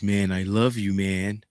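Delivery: soft, quiet, heartfelt "I love you"; tender quote. Retold or imitated. To his best friend, a soft, sincere goodbye. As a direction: say it gently to your best friend. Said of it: "The last thing I told him was".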